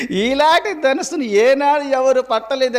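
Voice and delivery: high-pitched astonishment with a laugh; amazed Indra. Retold or imitated. Indra marvels at Satyabhama holding the bow, amazed. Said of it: "ఇంద్రుడు ఆశ్చర్యపోయినాడంట".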